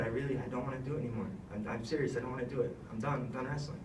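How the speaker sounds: soft, dejected quitting; softly telling his dad he's done; quiet. Retold or imitated. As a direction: say it low like a beaten kid. Child telling dad he quits.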